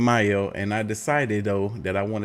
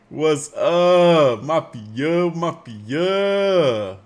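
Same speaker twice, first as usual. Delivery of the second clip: hype greeting; 6 semitones higher, 6 dB louder, much slower than usual. Acted. Hyped stream greeting.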